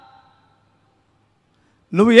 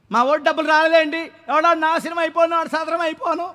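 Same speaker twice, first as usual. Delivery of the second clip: lightly imitated grumbling voter, higher and softer; mock complaint, pitched up; 5 semitones higher, 7 dB quieter than usual. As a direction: mimic a voter's complaint in a raised, small voice. Mimics voters grumbling over money.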